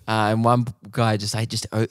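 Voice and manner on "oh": usual speaking voice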